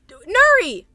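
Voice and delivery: high, loud cry of a name; laughing call-out. Real, not acted. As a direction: exclaim the name loudly through laughter. Calls out at Nuri, laughing.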